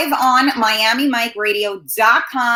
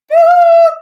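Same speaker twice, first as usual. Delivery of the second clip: loud, very high held hoot; high playful whoop; 10 dB louder than usual. Real, not acted. Host showing off her shirt on camera, playful whoop. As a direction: let out a piercing, playful whoop. Playful noise after boob shot.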